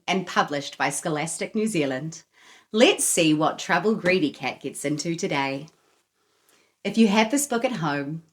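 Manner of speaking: usual speaking voice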